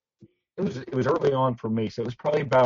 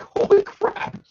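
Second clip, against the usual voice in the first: high, strained retold disbelief; loud, stunned reaction acted out; shocked exclamation; 5 dB louder, much slower than usual. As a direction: blurt it out, reliving the shock. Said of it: "And I was shocked".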